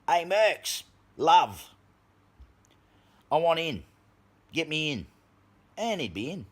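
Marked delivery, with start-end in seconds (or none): 0.1-5.1 mock Soros voice. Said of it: "and says".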